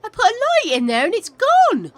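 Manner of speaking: high, loud disbelieving outburst; exasperated shout. Real, not acted. Streamer yelling at her game when the light vanishes, loud disbelief. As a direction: yell it at the screen in disbelief.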